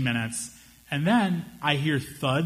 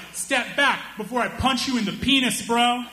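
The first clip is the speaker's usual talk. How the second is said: clumsy threat; 9 semitones higher than usual. Retold or imitated. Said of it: "he hadn't workshopped any of his insults".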